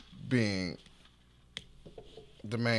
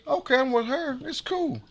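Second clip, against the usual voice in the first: much higher-pitched inner voice; high, light re-enacted thought; retold self-assurance; 14 semitones higher than usual. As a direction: lift the pitch way up, reassuring yourself. Retold confident thought to himself.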